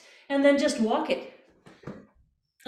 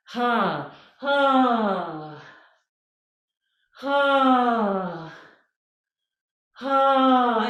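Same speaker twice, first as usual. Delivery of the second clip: growing louder yawning ha; yawny ha; 4 dB louder, much slower than usual. Shown as a demonstration. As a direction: swing out a yawny ha. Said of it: "yawny vowels".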